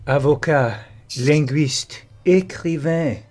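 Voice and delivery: reading out in a put-on European French voice; theatrical European French; European French accent. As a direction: play a Frenchman introducing himself.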